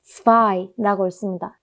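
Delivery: ordinary talk, nothing marked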